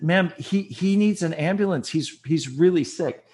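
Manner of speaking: begging for an ambulance, voice up and pressing; wife begs the 911 operator to send an ambulance, urgently; desperate plea. Retold or imitated.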